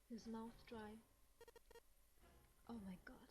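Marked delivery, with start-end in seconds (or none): 2.7-3.3 breathless, horrified whisper; shocked whisper. Acted. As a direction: gasp it out under your breath. Shocked at a bloody corpse.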